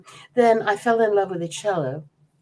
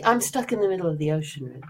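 ordinary talk

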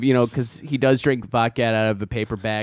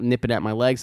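ordinary talk

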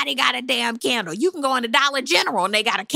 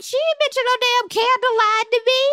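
much higher, offended mock Wendy; indignant Wendy; 14 semitones higher than usual. As Wendy about La Dame's candles, offended. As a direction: play Wendy insulted by the news.

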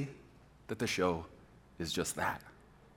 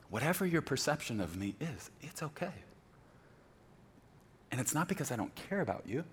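softer, gentle reassurance; calm secure voice; 11 dB quieter than usual. Retold or imitated. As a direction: say it softly, at peace with yourself. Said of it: "talk like this".

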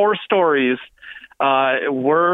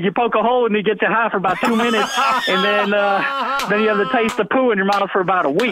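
put-on Southern accent, higher; 4 semitones higher than usual. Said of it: "the worst Southern accent".

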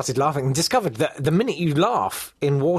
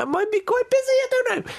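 much higher, squeaky dithering voice; flustered cousin; 3 dB louder than usual. Retold or imitated. Cousin squirming out of giving the old aunt a lift. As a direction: dither nervously, trying to get out of it. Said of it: "my cousin was like".

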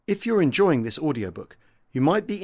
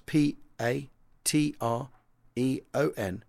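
spelling it out. Shown as a demonstration. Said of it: "That's Patreon, spelt".

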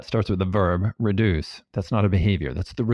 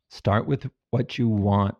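ordinary talk, nothing marked

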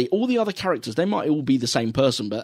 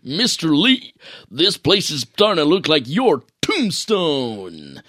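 slow, over-the-top action-hero voice; hammed-up game hero line; slower than usual. Quoting a bad game hero to his cohost, mock heroic. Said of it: "with the worst attempt at an accent".

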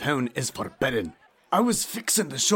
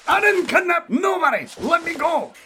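panicked shout; 14 semitones higher than usual. Acted. Accused courier shouting at the detectives. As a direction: yell your innocence. Accused thug protesting loudly.

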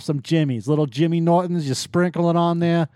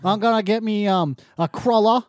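higher, exaggerated fake Massachusetts accent; bad Massachusetts accent; 5 semitones higher than usual. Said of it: "bad actors that don't know how to do a fucking accent from Massachusetts".

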